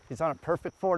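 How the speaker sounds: ordinary delivery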